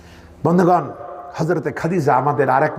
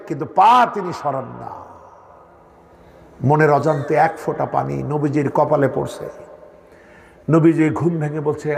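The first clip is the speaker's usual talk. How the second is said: normal talking voice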